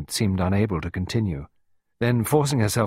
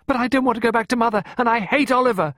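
fast, high, loud protest; desperate exclamation; 6 dB louder, faster than usual. A narrator's reading. Pippa begging not to return to Mother, desperate. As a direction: plead in high-pitched desperation. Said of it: "Pippa exclaimed desperately".

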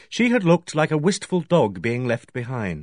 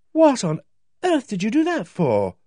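high, loud indignant demand; shocked and cross, she demands an answer; 11 semitones higher, 4 dB louder than usual. A narrator's reading. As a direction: Megan rubbing her knee demands why he pulled her in. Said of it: "she demanded".

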